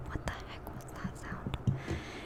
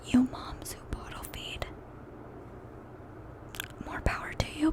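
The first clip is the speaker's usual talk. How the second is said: emphatic whisper; 13 semitones higher, 5 dB louder than usual. Real, not acted. Cheering on bottle-feeding moms.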